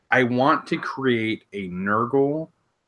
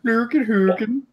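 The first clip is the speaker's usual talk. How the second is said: higher mock Nordic accent; Swedish Nordish accent; 9 semitones higher than usual. Quoting his friend's Space Wolf bit in a Nordic accent. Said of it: "Swedish Nordish kind of accent".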